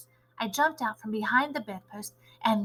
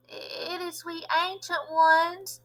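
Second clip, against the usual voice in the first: put-on timid old witch voice, higher, softer and slow; meek voice; 7 semitones higher, 4 dB quieter, much slower than usual. A narrator's reading. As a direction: shrink behind the door, afraid to speak. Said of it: "said a meek voice from behind the door".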